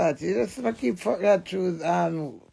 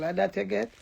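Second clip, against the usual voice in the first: ordinary talk, nothing marked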